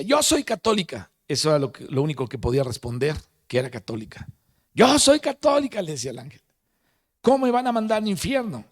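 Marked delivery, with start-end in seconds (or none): 0.0-1.1 desperate plea. Retold or imitated. 4.7-5.8 high, loud pleading cry; desperate shout. Retold or imitated. Doctor shouting to the angel, high pleading cry. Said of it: "a gritarle al ángel". 7.2-8.7 indignant plea. Retold or imitated. Doctor pleading before the angel.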